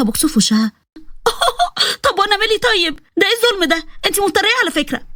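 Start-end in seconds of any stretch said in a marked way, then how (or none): 1.1-2.0 very high squealing laugh; a sudden high yelp of a laugh; shrill squeal. Acted. Girl punched, high laugh. 2.0-5.1 put-on whining in a much higher girl's voice; high whiny complaint. Girl hit unfairly, complains.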